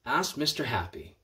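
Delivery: ordinary delivery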